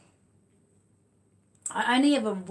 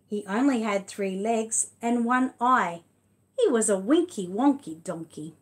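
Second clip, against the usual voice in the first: big, louder read-aloud voice, stretched slowly; reading to children; 2 semitones higher, 6 dB louder, slower than usual. Acted. Reading The Wonky Donkey to her son and viewers, lively read-aloud. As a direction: tell it like a lively picture book for little ones. Reads picture book aloud.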